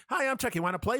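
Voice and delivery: high-pitched toy doll greeting; Chucky. Retold or imitated. Good Guy doll introducing itself to a kid, imitated toy voice. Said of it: "the good guy dolls".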